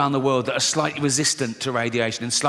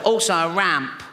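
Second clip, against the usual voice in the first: put-on funny little child voice; comic sickly kid's voice; Timmy; 3 semitones higher than usual. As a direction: play a small boy with a bad chest. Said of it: "a funny little voice 'cause his all, chest is all fucked".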